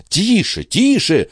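high, urgent hushing calls; insistent crowd cries; crowd crying out. A narrator's reading. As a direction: call for silence with pitch well up. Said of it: "закричали присутствовавшие".

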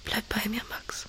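soft, pleading whisper. Real, not acted. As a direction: beg him quietly to stay. Pleading softly at the bedside.

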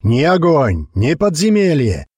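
rousing orator, high and slow; booming speech. A narrator's reading. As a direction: thunder it out to the crowd. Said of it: "гремел голос Черепа".

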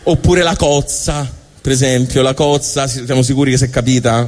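usual speaking voice

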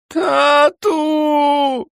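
very high, loud shriek of terror; the boy screaming for his father; terrified scream. A narrator's reading. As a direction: scream it in pure horror. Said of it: "із жахом прокричав Річард".

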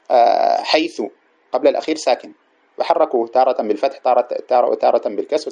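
normal talking voice

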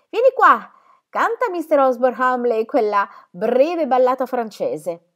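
molto più forte e più acuto; chiamata forte. Acted. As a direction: richiama Cinzia da lontano. Madre chiama Cinzia da lontano. (madre ordina a Cinzia di cantare, a gran voce)

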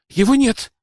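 startled cry, acted in narration; alarmed exclamation. A narrator's reading. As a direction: blurt out the shock of a loss. Discovers the motorbike is missing.

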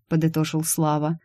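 usual speaking voice

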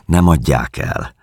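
ordinary delivery, nothing marked

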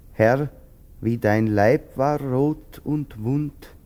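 slow, lifted prayer recitation; prayerful reading. Acted. Woyzeck reading his mother's Bible, slow prayer. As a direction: read the verse like a prayer.

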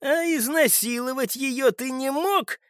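slow, high-pitched, indignant challenge; angry accusation. Acted. Man accuses the captive before hitting him, angry.

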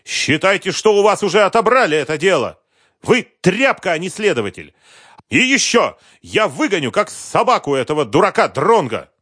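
high, loud contemptuous yelling; furious insults. Acted. Businessman insulting the investigator, furious shout. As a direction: spit the insults at him.

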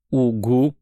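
held-back strained reply. A narrator's reading. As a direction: squeeze it out with effort. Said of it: "с трудом ответила девушка".

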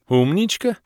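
high, rising incredulous echo; astonished echo. A narrator's reading. Hero amazed at Kellen's words, astonished. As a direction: echo it in disbelief. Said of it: "поразился я словам Кэлен".

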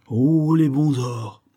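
drawn-out, high greedy swoon; greedy murmur. A narrator's reading. Greedy merchant dreams of gold, drawn-out rapture. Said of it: "murmura Isaac".

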